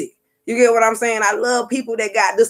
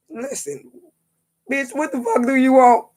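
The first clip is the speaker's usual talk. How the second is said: higher, sassy voice for the lady's glare; rude demand; 6 semitones higher than usual. Retold or imitated. The woman at her door, imagined as snapping at her with sass. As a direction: throw the attitude back like the stranger at the door.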